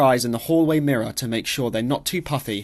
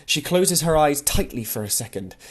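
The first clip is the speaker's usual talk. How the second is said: ordinary delivery, nothing marked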